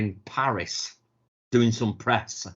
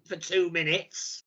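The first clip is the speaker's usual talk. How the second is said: usual speaking voice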